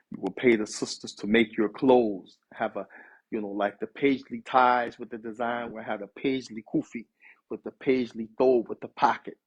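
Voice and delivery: ordinary talk, nothing marked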